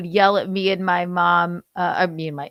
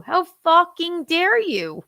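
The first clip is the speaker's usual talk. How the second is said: high, drawn-out indignation; outraged fight line; 9 semitones higher, 3 dB louder, slower than usual. Acted. Wife demonstrating another fight line, outraged. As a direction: act out slow, pitched-up outrage. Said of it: "what's another one?".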